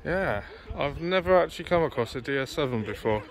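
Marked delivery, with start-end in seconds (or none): none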